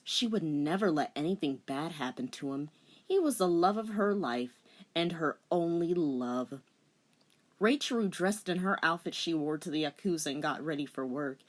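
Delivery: ordinary delivery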